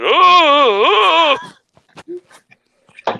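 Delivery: high, loud nervous "oh" moans; Beavis and Butthead principal. Retold or imitated. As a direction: whimper it like a frazzled principal. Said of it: "sounds like the fucking principal on Beavis and Butthead".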